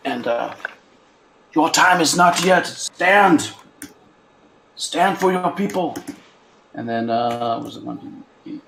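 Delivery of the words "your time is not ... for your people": raised, ringing in-character exhortation; solemn heroic command. Acted. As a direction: rouse a fallen comrade to rise. Healer's character commands the fallen ally.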